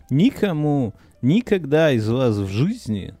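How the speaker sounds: ordinary talk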